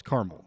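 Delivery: caramel as CAR-mul; short pronunciation. Shown as a demonstration. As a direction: run caramel into two syllables. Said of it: "It doesn't flow".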